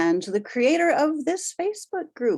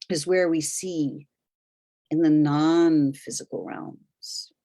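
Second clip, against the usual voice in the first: unhurried guide, letting each phrase settle; guided meditation; 6 semitones lower than usual. Real, not acted. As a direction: gently lead listeners inward, phrase by phrase. Leads a guided meditation journey.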